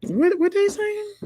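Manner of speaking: very high-pitched, loud comic confusion; baffled falsetto questioning; mock bewilderment. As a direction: squeal it in exaggerated disbelief. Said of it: "you be like".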